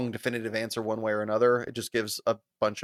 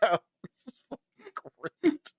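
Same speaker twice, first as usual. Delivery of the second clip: ordinary talk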